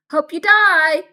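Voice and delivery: a loud yelled send-off; hallway classmates yelling back; shouted hope you die. Retold or imitated. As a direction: bellow it back down the hall as a cheery insult. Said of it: "people would walk back and be like".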